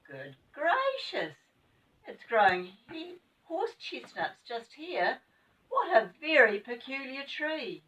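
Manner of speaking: high, slow astonishment; wide-eyed wonder at the tree; amazed. Acted. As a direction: marvel at the odd tree.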